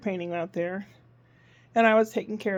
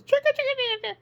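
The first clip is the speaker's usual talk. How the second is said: penguin toy sound; much faster than usual. Acted. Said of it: "So it goes".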